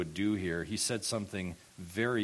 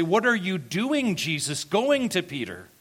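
loud, high-pitched incredulous protest; scandalized outcry, pitched way up; indignant reader; 11 semitones higher, 8 dB louder than usual. Acted. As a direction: shout it like an outraged objector. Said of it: "any reader of John's gospel would say".

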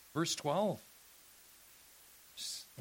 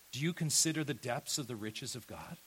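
normal talking voice

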